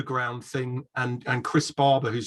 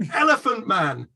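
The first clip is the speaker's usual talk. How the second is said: prompting shout; 9 semitones higher, 3 dB louder than usual. Retold or imitated. Producer shouting the answer.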